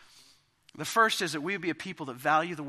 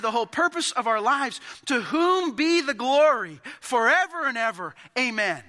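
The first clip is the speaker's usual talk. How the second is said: louder, higher preaching voice, urging the church; impassioned preaching; 7 semitones higher, 7 dB louder than usual. Acted. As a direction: plead with the congregation at full force. Preaching Paul's words at full force.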